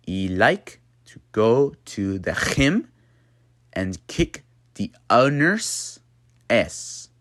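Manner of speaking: slow Dutch-accented English with gaps and rising phrases; slow accent model, "gym" as "khim". Shown as a demonstration. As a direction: spell it out slowly in a Dutch accent. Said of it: "If I were to slow it down".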